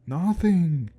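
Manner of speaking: loud and high with fear; shaken, voice pushed up high; frightened old man. Acted. Grandpa describes the ghost.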